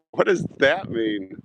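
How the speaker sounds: high incredulous question; high mock-baffled ask; mock-innocent surprise. Real, not acted. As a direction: play dumb, high. Feigned innocence at innuendo.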